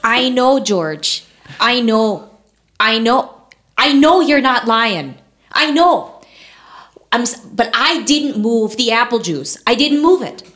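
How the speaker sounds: hammed-up Minnesota wife, emphatic and exasperated; Martha Washington. Wife denies moving the apple juice, exasperated Midwest accent. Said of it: "I just went for Midwest".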